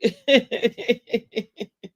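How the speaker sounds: high, rapid staccato giggle; drawn-out tittering laugh; real laugh. Real, not acted. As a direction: laugh helplessly in a long string. Amused by her own recap.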